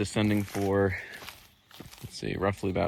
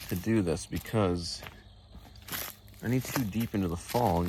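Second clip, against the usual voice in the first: ordinary talk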